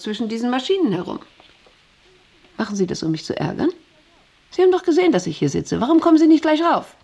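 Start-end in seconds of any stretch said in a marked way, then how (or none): 4.5-5.1 high, loud accusation; indignant, pitched right up; annoyed reproach. Acted. Reproaching the visitor. 5.8-7.0 high, loud, quick reproach; indignant rush of a question; annoyed demand. Acted. Reproaching the visitor.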